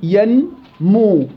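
"ينمو" very slowly in two long syllables, yan-mu; slow syllable split. Shown as a demonstration. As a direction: draw out ينمو, rising on ين and falling on مو. Teacher spells out the mnemonic word. («ينمو» ببطء شديد مقطعة إلى مقطعين)

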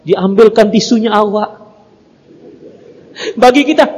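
usual speaking voice

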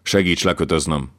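ordinary delivery, nothing marked

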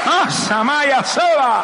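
high, drawn-out ecstatic cry; fervent, stretched-out tongues shout; speaking in tongues. Real, not acted. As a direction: cry it out slowly in a soaring voice.

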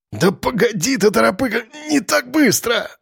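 high voice cracking toward a wheeze; strained joking. A narrator's reading. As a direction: try to joke while being throttled, pitch climbing. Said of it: "Всё ещё пытался шутить Рыжий, но голос его сорвался на сип".

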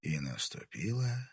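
soft, slow, broken by pauses; fading. A narrator's reading. Narrator as Timofey loses consciousness, fading away. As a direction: let it die away slowly. Конец сцены, Тимофей умирает.

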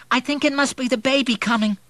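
high, quick, loud alarm; alarmed maid. A narrator's reading. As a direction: blurt it out in a fluster. Maid alarmed at early labour.

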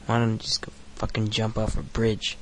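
ordinary talk

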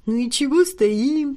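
slow squeaky mimicry of a girl; squeaky mocking voice. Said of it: "писклявым голосом передразнил он".

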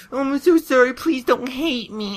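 very high, whimpering apology voice; pleading pony. Acted. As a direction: beg pitifully in a squeaky, high voice. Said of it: "And she's like".

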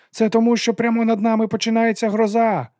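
high, loud panicked yell. A narrator's reading. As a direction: bellow it over the storm. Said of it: "заволав Лобсанг".

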